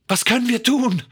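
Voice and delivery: high, alarmed voice; desperate question. A narrator's reading. Marion asking George for help, desperate. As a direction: ask it urgently, pitched right up.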